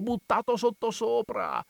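put-on high, alarmed old curate, pressing; anxious old man scolding in disbelief; fretful old priest. A narrator's reading. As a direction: Don Abbondio frets at reckless Renzo.

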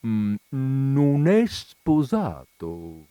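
slow, musing under her breath; pensive. Acted. The colonel's wife musing on the lodger, slow and pensive. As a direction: turn the idea over slowly, lost in thought. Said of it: "disse pensierosa".

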